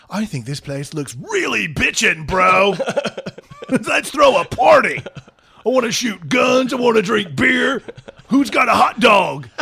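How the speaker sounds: loud, high party-bro shouting; rowdy American bro. Retold or imitated. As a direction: whoop it up like a rowdy frat bro. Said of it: "he's like".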